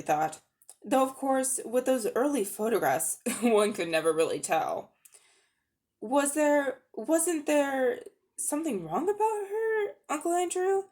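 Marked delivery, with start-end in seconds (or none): 6.0-10.8 put-on higher, slow, worried boy's voice; a boy asking anxiously, pitched up; young boy Diggory. A narrator's reading.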